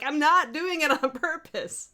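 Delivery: put-on high, slow Scottish-accented protest. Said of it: "I'd take on this Scottish accent".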